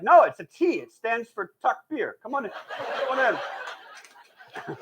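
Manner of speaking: mock welcoming greeter. Mock church greeter explaining cross.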